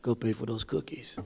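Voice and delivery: low, hushed whisper in the ear; security guard quietly whispering to a young shoplifter. Retold or imitated. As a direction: lean in and whisper it low and soft, just for him. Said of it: "he whispered in my ear".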